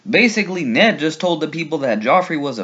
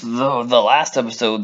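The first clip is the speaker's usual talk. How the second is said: ordinary talk, nothing marked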